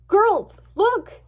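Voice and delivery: loud, high call to look; gasped, high exclamation; urgent alarm. A narrator's reading. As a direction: alert your friends suddenly. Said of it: "Sunshine gasped and pointed".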